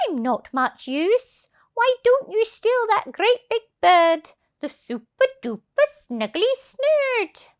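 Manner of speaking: high, loud sly goose voice; hissing goose. Acted. Goose tricking the thieves, loud and high. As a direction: hiss out the suggestion slyly. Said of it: "she hissed at them".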